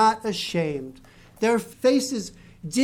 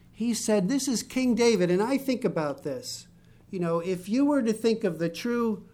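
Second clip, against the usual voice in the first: normal talking voice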